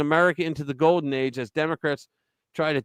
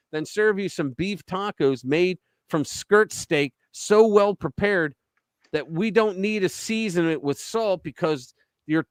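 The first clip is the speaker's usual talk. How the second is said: lively, raised reading voice for a comic opinion piece; performing a tongue-in-cheek editorial; editorial read aloud; 6 semitones higher than usual. Acted. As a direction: read the piece like a punchy column. Reads opinion piece aloud with flair.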